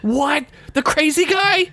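high, startled question; jolted by the news, asks in disbelief; shocked. Real, not acted. Co-host reacting to the death news, shocked and high.

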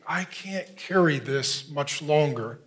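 low, soft and slow; acted exhaustion, hushed and heavy; weary, burdened. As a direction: voice someone worn down, slowly and quietly. Voices a burdened, weary believer.

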